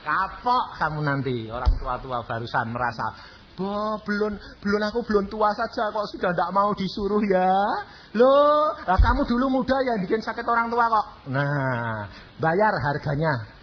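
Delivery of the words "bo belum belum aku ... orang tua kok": high, indignant scold; scolding parent. Retold or imitated. Aging parent scolding the disobedient child, high and indignant. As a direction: tell off the child like an offended parent.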